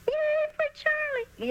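very high, slow squeaky cheer. A narrator's reading. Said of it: "yelled the girl who was the squeaky voice".

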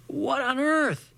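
shocked. A narrator's reading. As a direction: gasp it out in disbelief. Shocked exclamation.

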